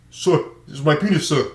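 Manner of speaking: imitated thick African accent. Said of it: "in his thick African accent".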